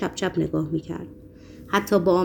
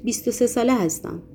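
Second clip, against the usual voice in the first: usual speaking voice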